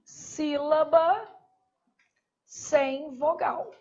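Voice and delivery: drawn out word by word for notes; slow, deliberate teacher dictation. Shown as a demonstration. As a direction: spell it out slowly for the class. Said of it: "não existe o quê?".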